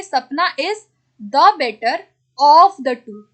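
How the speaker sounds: a teacher hammering home of the two; stressed of the two. Shown as a demonstration. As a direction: punch of the two so the class notices it. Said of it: "क्या लगाएंगे हम यहाँ पे".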